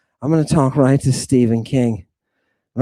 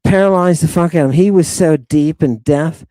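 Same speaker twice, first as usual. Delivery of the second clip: usual speaking voice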